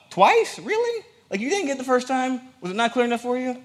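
very high, squeaky-pitched disbelief; mock incredulity. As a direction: chide them with exaggerated high-pitched surprise. Mock incredulity at needing repetition.

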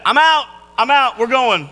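acted frantic urgency; urgent announcement. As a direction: blurt it as if bolting out the door. Student rushing out of school.

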